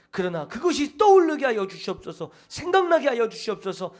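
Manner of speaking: fervent prayer. Shown as a demonstration. Preacher urges the congregation to pray this way, fervent and loud. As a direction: cry it out to God with force. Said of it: "그렇게 기도하는 것입니다".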